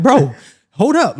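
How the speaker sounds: loud, high, drawn-out retold protest; exasperated plea. Stunned teen holding off his boys, loud exasperated plea. Retold fending off friends in shock.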